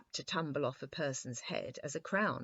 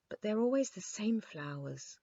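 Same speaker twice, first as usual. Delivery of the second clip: complaining; 6 semitones higher than usual. A narrator's reading. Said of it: "complained His Majesty".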